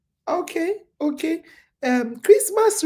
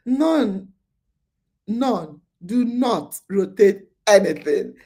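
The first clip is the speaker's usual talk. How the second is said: usual speaking voice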